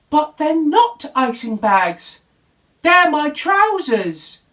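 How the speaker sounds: fighting back tears; sniffing, near tears. Acted. Little Bear protests to Bramwell, near tears and slow. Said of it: "sniffed Little Bear".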